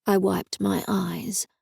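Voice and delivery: ordinary delivery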